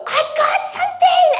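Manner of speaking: put-on girl's high excited shout; excited cousin. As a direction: shriek it like a kid with a bite. Cousin hooks a big fish.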